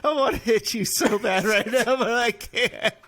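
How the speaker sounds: high, laughing mock threat to a friend; can't stop laughing at the tease. Real, not acted. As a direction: joke about hitting him while cracking up.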